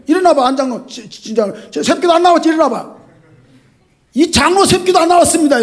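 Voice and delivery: loud, high fatherly scolding; mock scolding. Shown as a demonstration. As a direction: scold the elder like a stern father. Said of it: "이렇게 할 수 있어야 되거든". (호통치듯 높고 크게 꾸짖는 목사)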